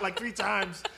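ordinary talk

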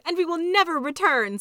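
high, loud climactic declaration; rousing high-pitched proclamation; rallying cry. A narrator's reading. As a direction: cap the chorus with a ringing vow. Handmaids rally one after another.

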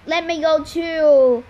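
loud, drawn-out whiny grumble; grumbling. A narrator's reading. Left-out henchman asking his boss to come, grumbling. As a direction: complain slowly, leaning on the last word. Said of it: "Agrius grumbled".

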